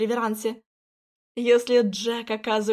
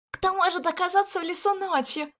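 higher, startled worried voice; frightened; 8 semitones higher than usual. Acted. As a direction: voice jumps up in alarm. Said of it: "Я испуганно схватила".

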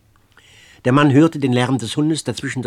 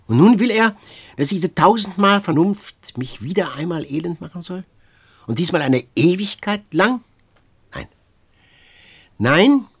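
passionate insistence; 6 semitones higher, 4 dB louder than usual. Acted.

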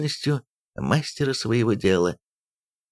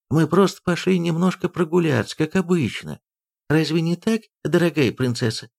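higher-pitched, mildly hurried-out excuse; blurted excuse; 7 semitones higher than usual. A narrator's reading. Nursemaid tries to ease the princess's lot, lightly blurting. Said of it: "кормилица выпалила".

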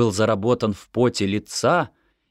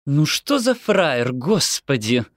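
slow, gritted-teeth grumble; through gritted teeth; 6 semitones higher, slower than usual. A narrator's reading. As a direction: grind it out instead of thanks. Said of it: "процедила сквозь зубы мама". (процедила сквозь зубы)

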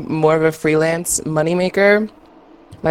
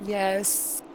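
far from mic; 3 semitones higher, 14 dB quieter than usual. Real, not acted. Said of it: "There you are".

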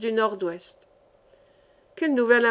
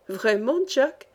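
usual speaking voice

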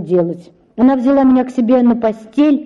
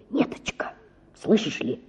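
hushed plea; 10 dB quieter than usual. Acted. Father secretly begging daughter.

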